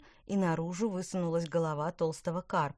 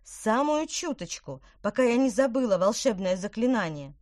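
pleading; 5 semitones higher, 7 dB louder than usual. A narrator's reading. Irritated witch pleads.

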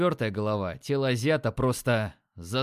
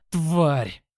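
furious roar, louder; 3 semitones higher, 6 dB louder than usual. Acted. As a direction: roar the word out. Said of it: "взревел я".

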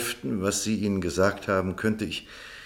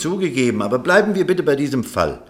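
louder, higher, insistent pushback; heated retort; 4 semitones higher, 7 dB louder than usual. Acted. Doctor cuts the lawyer off, loud insistent retort. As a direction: raise your voice to take back control.

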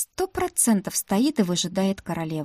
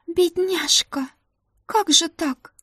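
pitying Ruta; 9 semitones higher, slower than usual. Acted. Ruta pitying her friend.

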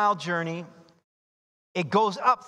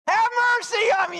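desperate cry; 5 dB louder, faster than usual. Acted. Blind men crying out.

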